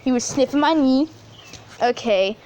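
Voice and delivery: normal talking voice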